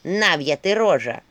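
loud, slow, relishing accusations; very feisty. A narrator's reading. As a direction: hurl each charge with fighting zest. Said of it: "Голос её был очень азартным". (азартно, громко бранясь)

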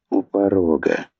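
usual speaking voice

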